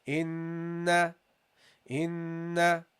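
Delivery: "inna" with a long, slow nasal hum on the n; held nasal ghunna. Shown as a demonstration. Teacher models the nasal hum for students, slow. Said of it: "you have to extend it".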